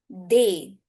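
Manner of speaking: normal talking voice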